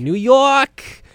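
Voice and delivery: high, loud drawn-out New York holler; mock New York accent. Host echoes co-host's cheer, mock New York accent. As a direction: echo it as a rowdy New Yorker.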